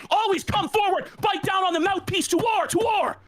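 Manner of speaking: rally like a fighter before the bell; fired-up rant. Acted. Ranting at Jeremy, a fired-up war cry.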